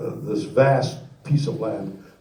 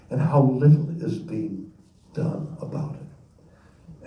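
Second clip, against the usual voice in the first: ordinary talk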